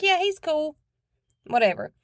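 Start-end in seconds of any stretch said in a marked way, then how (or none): 0.0-0.8 put-on high, offhand kid voice; imitating the boy agreeing casually; little boy voice. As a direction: shrug it off like a toddler. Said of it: "And he's like".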